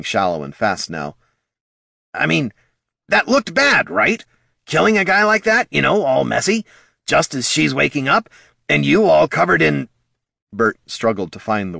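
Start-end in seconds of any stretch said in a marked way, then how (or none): 2.1-9.9 much higher, louder fretting kobold; anxious kobold. A narrator's reading. Bert explaining to Thane how the killing looked. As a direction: babble anxiously to the troll.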